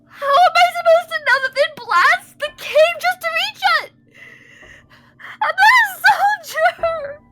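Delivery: high, loud, overwhelmed cries; panicked tearful yelling. Acted. Remy protesting being no soldier, panicked tearful yelling. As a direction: shriek it in panic, through tears. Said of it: "Remy, don't panic".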